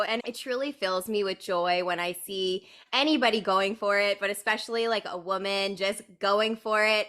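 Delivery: ordinary talk, nothing marked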